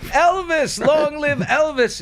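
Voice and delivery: screaming crowd. Acted. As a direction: call out like a fan on the tarmac. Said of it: "They're all screaming".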